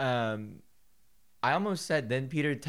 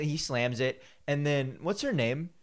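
normal talking voice

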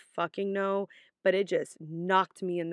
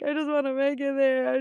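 mock crying; 6 semitones higher, 4 dB louder than usual. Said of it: "I started crying".

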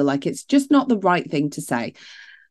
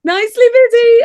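very high, loud squeal; delighted high squeal; 8 dB louder than usual. Real, not acted. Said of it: "which I find hilarious".

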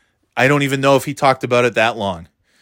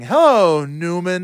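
lightly imitated comic catchphrase, far less shrieky; Jerry Seinfeld; 2 semitones higher, slower than usual. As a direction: deliver the catchphrase with a measured build, lightly acted. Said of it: "as Jerry Seinfeld is saying".

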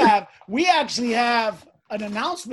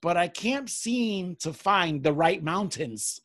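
slow letter reading; 4 semitones lower, much slower than usual. Acted.

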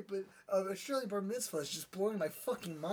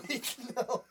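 usual speaking voice

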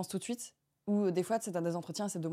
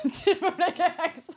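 laughing disbelief; 9 semitones higher, 5 dB louder than usual. Real, not acted.

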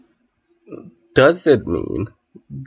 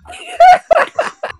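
shrieking burst of laughter; high squealing laugh; 4 dB louder than usual. Real, not acted.

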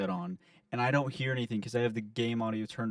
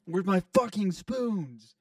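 high-pitched, hammed-up gamer outrage; mock rant; 5 semitones higher, 4 dB louder than usual. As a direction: rant in exaggerated indignation at your teammates. Acts out own ranting in game.

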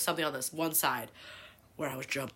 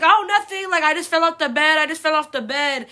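high, loud, too-innocent denial; nervous kid cover-up; 10 semitones higher, 10 dB louder than usual. Retold or imitated. Kid to dad after breaking the bed, loud innocent denial. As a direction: brush it off loudly, hiding the guilt. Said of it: "I was like".

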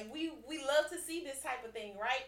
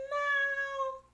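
playful retort; 13 semitones higher, 7 dB louder than usual. Retold or imitated. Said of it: "And you say".

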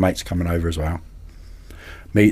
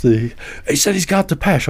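ordinary talk

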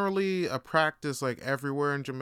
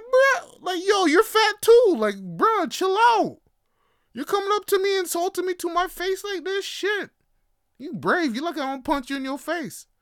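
high, loud, exasperated clapback; indignant comeback; 14 semitones higher, 6 dB louder than usual. Retold or imitated. He snaps back at the kid who called him fat, high and loud. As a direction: push back at the kid, fed up. His angry reply to the kid.

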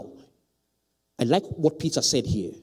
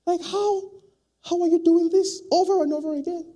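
amazed question; 5 dB louder than usual. Pretend. Preacher acts amazed questioning.

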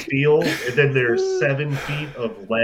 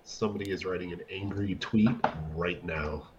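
hushed aside; 6 semitones lower, 10 dB quieter than usual. Real, not acted. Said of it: "hushed joke".

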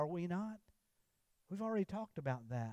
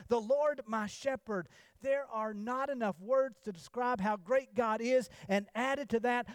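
lightly raised sermon voice; preaching; 6 semitones higher, 5 dB louder than usual. Acted. Pastor lists God's names to the congregation, rising preaching voice.

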